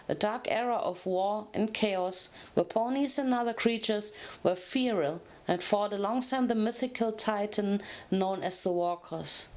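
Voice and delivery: plain reading voice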